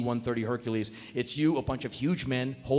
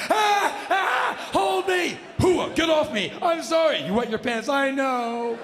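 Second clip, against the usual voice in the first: high frantic yelling; cling and beg in mock terror; hammed-up panic; 11 semitones higher, 4 dB louder, slower than usual. Screaming and crying on a man.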